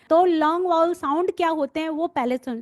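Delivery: ordinary delivery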